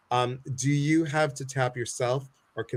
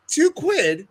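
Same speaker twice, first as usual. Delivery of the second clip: high-pitched, louder mock Brit; exaggerated British accent, pitched way up; 6 dB louder than usual. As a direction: squeal it like a cartoon Brit. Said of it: "when I do a, a British accent".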